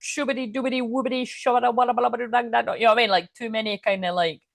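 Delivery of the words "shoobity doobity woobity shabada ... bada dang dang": high, put-on mystic voice; mock channeler voice. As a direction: send up a fake spiritual channeler. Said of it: "people that are like".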